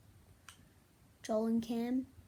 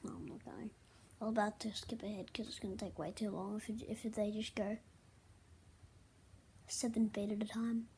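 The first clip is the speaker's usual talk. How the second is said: whisper; 4 semitones lower, 10 dB quieter than usual. Real, not acted. Muttering aside while skipping ahead.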